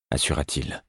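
ordinary delivery

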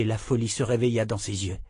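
plain reading voice

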